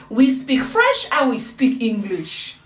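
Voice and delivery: loud, slow mock French accent; playful, drawn-out French accent. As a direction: announce it grandly in a French accent. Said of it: "testing out this French accent".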